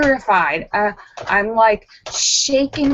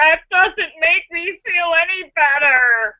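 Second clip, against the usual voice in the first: mock protest; 7 semitones higher than usual. Real, not acted. Mock complaint at Marcel's advice.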